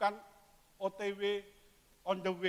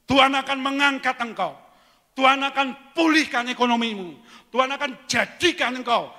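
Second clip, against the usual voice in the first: louder, higher proclamation; proclaiming; 6 semitones higher, 12 dB louder than usual. Retold or imitated. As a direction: proclaim the promise boldly. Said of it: "Lalu pendeta bilang". (proklamasi penuh semangat)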